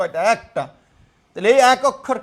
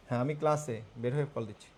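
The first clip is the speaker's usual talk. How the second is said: much softer and lower, talking privately; hushed phone aside; 7 semitones lower, 13 dB quieter, faster than usual. Real, not acted. Teacher putting off a caller mid-class, hushed. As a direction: keep it down for the caller.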